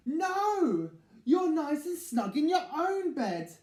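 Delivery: loud, high refusal; firm kind refusal. Acted. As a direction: put him back to bed. Big Mouse firm.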